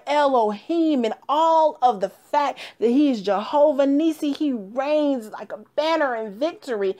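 full-force church preaching. Acted. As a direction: preach it out to the congregation.

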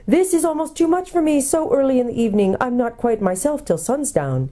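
high, flustered owl voice; hooting owl. A narrator's reading. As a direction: start high and hooty, settle a little. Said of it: "hooting kind of voice".

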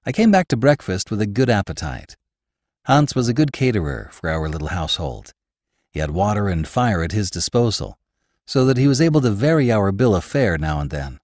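ordinary delivery, nothing marked

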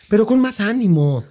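high, cheerful coaxing; playful encouraging nudge; mock pep. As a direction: pump the kid up, pitched high. Coaching child to answer.

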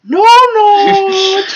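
very high, loud, drawn-out wail; mock anguished cry. Co-host reacting to Moriarty's line, mock anguished cry. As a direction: cry out in exaggerated dismay. Mock horror, cried out once more.

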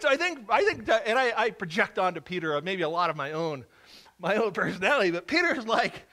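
ordinary delivery

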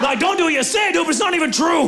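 high, indignant shout; screaming match. Acted. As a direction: shout your denial at your wife. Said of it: "We've had some screaming matches".

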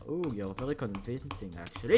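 ordinary talk

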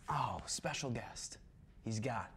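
slow, low, near-whispered tease; hushed announcement. Acted. Host announcing next week's guest, hushed and slow. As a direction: drop it to a secretive murmur.

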